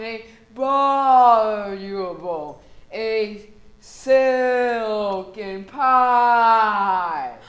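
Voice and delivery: slow, creepy horror-movie voice; Boris Karloff. Acted. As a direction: drag it out like a monster. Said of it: "You sound like Boris Karloff".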